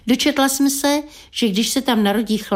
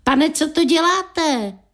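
mild mock cry upward; 7 semitones higher, slower than usual. A narrator's reading. Local man calling up to [name]'s window, mild high cry. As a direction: call up to a window, stretching it slightly. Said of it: "vykřikl vzhůru".

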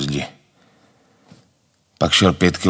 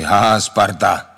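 drawn-out mock laugh and jeer; slow, taunting imitation of others poking fun; imitated teasing; 3 semitones higher, 3 dB louder, slower than usual. Inmates tease a man who hates Sparta, imitated mockery. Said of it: "dělají srandu".

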